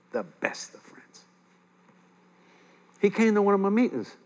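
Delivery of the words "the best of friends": hushed, slow whisper of "best of friends". Real, not acted. Preacher recalling his lost best friend, echoed in a whisper. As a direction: drop to a breathless whisper of memory. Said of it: "We were the best of friends".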